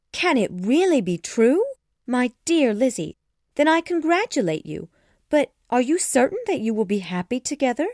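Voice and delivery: high, loud, wide swings of pitch; astonished but affectionate questioning; amazed delight. A narrator's reading. Jane asks her sister if it's true, amazed delight.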